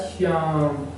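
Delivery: श्याम with a held आ; stretched, level श्याम; prolonged vowel. Shown as a demonstration. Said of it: "अधिक समय लग रहा है".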